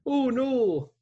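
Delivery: high, loud mock-Canadian alarm; hammed-up hoser raising the alarm; broad joke Canadian panic. Mock Canadians fleeing zombies.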